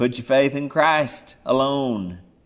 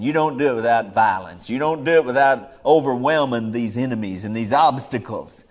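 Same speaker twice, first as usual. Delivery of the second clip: normal talking voice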